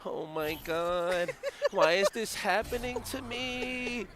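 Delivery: very slow, high, drawn-out whine; miserable sick friend. Retold or imitated. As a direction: wail it slowly like he's about to be sick. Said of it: "he leans back and he goes".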